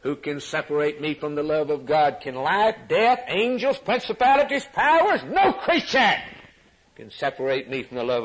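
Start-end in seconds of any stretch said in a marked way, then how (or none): 0.0-6.2 scripture questions climbing to a high shout; rising, louder with each item listed; building preaching. Acted. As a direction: start near normal and build to full pulpit cry.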